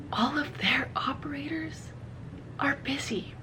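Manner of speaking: whispered in disbelief; exasperated whisper. Retold or imitated. Retelling yet another failed emergency call, exasperated whisper. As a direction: drop to a despairing whisper.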